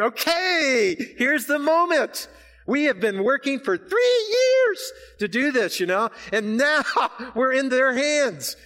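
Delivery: high, slow, keyed-up retelling of the moment; nervous anticipation, laughing at the end; tense excitement. Retold or imitated. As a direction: build the suspense of waiting. Retelling tense moment before verdict.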